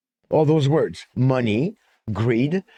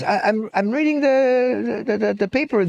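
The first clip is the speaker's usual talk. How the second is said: hesitant stammer; 8 semitones higher than usual. Acted. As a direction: stammer through an awkward excuse. Challenged friend stammers in joke.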